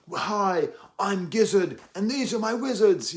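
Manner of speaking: put-on loud, high Australian accent gone British; Australian attempt that lands as British; botched Australian accent. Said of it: "trying to do an Australian accent there. It ended up as a British accent".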